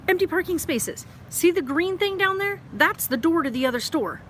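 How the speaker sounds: high, loud and incredulous; exasperated pointing-out. Real, not acted. Showing viewers the parking outside, loudly exasperated.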